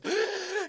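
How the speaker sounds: sharp high gasp; big gasp for air. Retold or imitated. Kid emerging from sleeping bag, mock gasp. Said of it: "gets a big gasp of air".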